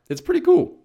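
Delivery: giddy promo excitement; excited. Real, not acted. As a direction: burst out thrilled. Plugging her guide.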